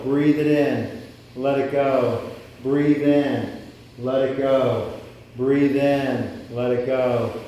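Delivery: loud, rhythmic breath commands, each word punched; breathwork cueing. Acted. Breathwork leader calling inhale and exhale to the group, loud and rhythmic. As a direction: drive the room's breathing like a coach counting reps.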